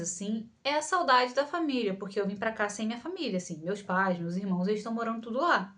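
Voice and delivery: ordinary delivery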